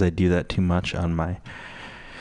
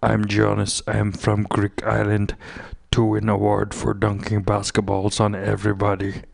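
mock Greek accent. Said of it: "fucking Balade voice".

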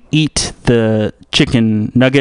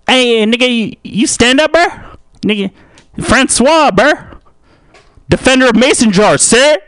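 high-pitched, excitable heckler voice; high put-on voice with rising line ends; taunting heckler; 10 semitones higher than usual. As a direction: goad them in a high, pushy voice. Plays the heckler again.

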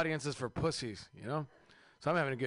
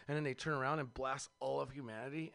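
ordinary talk

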